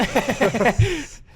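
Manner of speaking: loud hissing creeper sound; creeper impression. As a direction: hiss like a Minecraft creeper. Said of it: "I can do a creeper".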